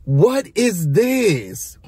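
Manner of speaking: very slow, incredulous question; drawn-out disbelief. Real, not acted. Recapper reacts to the nap invitation, drawn-out disbelief. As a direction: stretch each word in bafflement.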